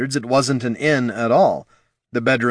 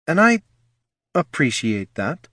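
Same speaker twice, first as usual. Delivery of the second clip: careful; 7 semitones higher than usual. A narrator's reading. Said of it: "said Loruna carefully".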